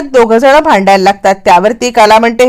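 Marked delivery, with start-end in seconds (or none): none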